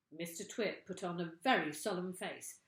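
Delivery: usual speaking voice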